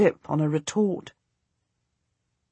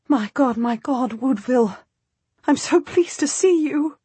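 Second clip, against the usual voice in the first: relieved; 5 semitones higher than usual. Acted. Relieved lady greets rescuer.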